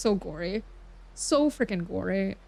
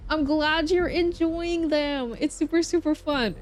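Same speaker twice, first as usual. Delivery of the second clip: excited approval; 7 semitones higher, 3 dB louder than usual. Real, not acted. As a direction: gush happily for a friend. Cheering on a viewer.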